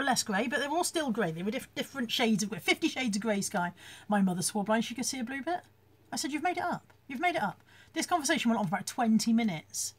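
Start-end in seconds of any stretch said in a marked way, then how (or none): 6.3-7.6 higher-pitched bickering; arguing, voice lifted; retold exasperated accusation. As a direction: accuse your mother of inventing it, insistently. Retold argument with mother.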